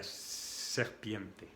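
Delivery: "serpiente" with a long hissed first s; snake-like S. Shown as a demonstration. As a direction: stretch the opening S of "serpiente". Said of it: "la letra de la serpiente".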